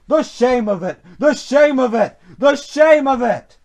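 an affronted engine's protest; indignant grumbling. A narrator's reading. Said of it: "he grumbled".

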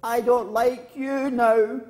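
high, loud, slow cross call; cross girl calling out. Acted. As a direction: shout it slowly at the hills. Girl in story shouts at hills.

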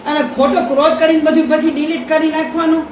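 loud scolding rant. Real, not acted. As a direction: tell her off for hiding the phone. Mother scolds daughter over phone.

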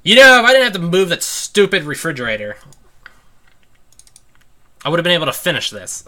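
real frustration spilling out; frustrated rant. Real, not acted. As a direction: vent the anger, voice climbing high. Venting about refrigerator.

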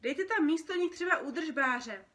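brisk phone ordering. Acted. Dream worker ordering circus props by phone, brisk and loud. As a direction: rattle off an order down the line.

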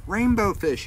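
high, small fish voice calling; little fish calling. Acted. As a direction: call out like a tiny fish. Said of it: "he called".

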